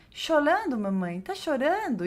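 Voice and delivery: a hint of a small child's high question; child asking. Child asking crying mother, lightly high-voiced. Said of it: "ela fala assim".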